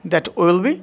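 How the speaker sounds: ordinary delivery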